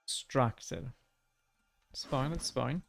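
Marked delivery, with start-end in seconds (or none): none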